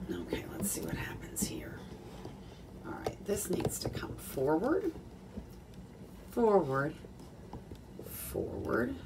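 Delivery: hushed, half-voiced self-talk; quietly thinking aloud; muttering to self. Real, not acted. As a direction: murmur absently while fiddling with equipment. Muttering to self while adjusting camera.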